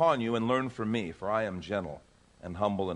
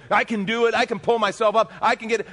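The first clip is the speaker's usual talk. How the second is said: self-striving; 9 semitones higher, 7 dB louder than usual. Retold or imitated. Self-striving person voiced.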